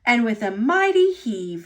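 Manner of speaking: usual speaking voice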